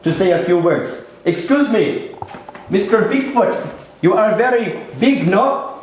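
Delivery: put-on earnest, lilting Indian-accented reporter; Indian news reporter. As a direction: play an upbeat field reporter from India. Indian reporter interviews Bigfoot.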